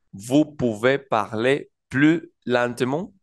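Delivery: slow, careful French "Vous pouvez parler plus lentement?" with a rising question; French pronunciation demo. Shown as a demonstration. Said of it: "La primera de ellas que tenemos dice". (demostración lenta en francés)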